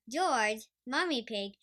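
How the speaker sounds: plain reading voice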